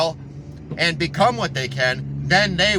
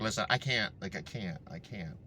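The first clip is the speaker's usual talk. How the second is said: starting softer and fading almost to nothing, worn out; fading weary refusal; 7 semitones lower, 13 dB quieter than usual. Retold or imitated. Weary Zen master fades out refusing students, softer and softer. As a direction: let each can't die away.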